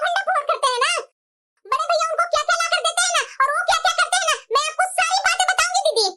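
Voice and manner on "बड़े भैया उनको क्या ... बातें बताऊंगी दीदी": ordinary talk, nothing marked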